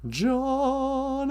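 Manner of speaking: ordinary talk, nothing marked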